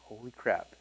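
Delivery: low, very soft amazement; acted-out quiet disbelief; stunned hush. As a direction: let it out under the breath, low. Stunned at seeing the sales.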